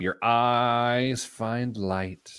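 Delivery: like leading a meditation, slowly. Acted. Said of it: "That was like we're meditating".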